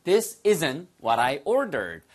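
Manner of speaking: very slow, clear model sentence; slow teacher demo. Shown as a demonstration. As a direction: say it slowly so they can copy. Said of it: "됐죠?".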